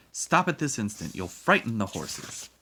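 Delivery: loud, crisp command, starting high; brisk, authoritative scolding. A narrator's reading. Stern woman turns on the tantruming boy, crisply. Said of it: "she said crisply".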